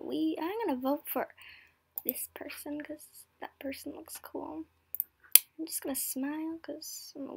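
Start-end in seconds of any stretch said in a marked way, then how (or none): 2.0-7.4 whisper. Real, not acted. Whispered voting aside.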